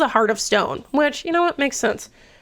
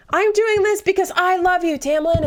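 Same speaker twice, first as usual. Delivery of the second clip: high-pitched, drawn-up lover's plea; mock heartfelt declaration; 9 semitones higher than usual. Feyre declaring her love to Tamlin, high mock-earnest.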